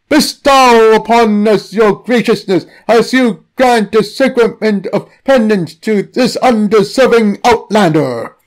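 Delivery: beseech a god in high ceremony; solemn priestly plea. Acted. Priest praying at the altar.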